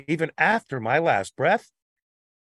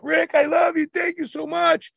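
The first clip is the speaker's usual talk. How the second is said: gushing fan; 14 semitones higher than usual. Retold or imitated. Retold fan gushing thanks.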